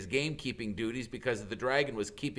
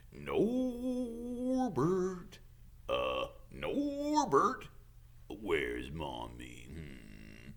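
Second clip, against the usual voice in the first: put-on cooing baby talk; 3 semitones lower, 4 dB quieter, much slower than usual. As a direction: coo to the baby dragon lovingly. Hagrid cooing to dragon.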